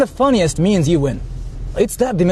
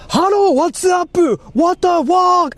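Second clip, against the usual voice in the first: much higher, slow, drawn-out, ending in a shout; mock Osaka guy speaking English; 11 semitones higher, slower than usual. Imitating an Osaka local greeting a foreigner in English, high, slow and shouted. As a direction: ham up a Japanese local blurting English swear words at a foreigner. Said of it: "trying to talk to foreigners in English".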